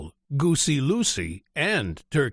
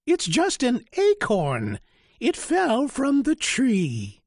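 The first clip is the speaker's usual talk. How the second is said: high goose voice, matter-of-fact; sensible goose; 12 semitones higher than usual. Acted. Goose tells the chick it was no sky. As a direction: set the chick straight as the goose. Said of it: "Goosey Lucy said".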